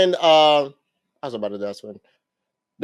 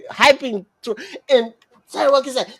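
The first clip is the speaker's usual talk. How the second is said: usual speaking voice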